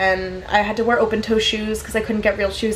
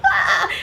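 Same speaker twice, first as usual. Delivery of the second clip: retold shriek; 11 semitones higher than usual. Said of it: "And I'm like".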